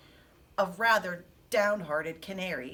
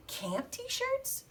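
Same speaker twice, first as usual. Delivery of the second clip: high, soft, surprised question; interrupting Ralph; 14 semitones higher, 6 dB quieter than usual. Acted. Mouse interrupting the hamster's camp story, high soft questioning voice. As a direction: cut in with a high, hushed query. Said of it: "interrupted Ralph".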